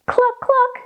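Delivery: high hen-like cluck; clucking cow. A narrator's reading. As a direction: cluck high, as a cow who lost her moo. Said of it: "said cow".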